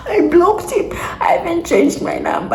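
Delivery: high, squeaky voice through laughter; laughing. Real, not acted. Storyteller cracking up over blocking the man, laughing. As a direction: repeat it helpless with laughter, voice shooting up. Laughs as repeating what they did.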